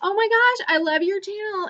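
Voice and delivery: high-pitched imitated fan gush. Nice fan coming up to the YouTuber, gushing. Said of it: "other people are so nice".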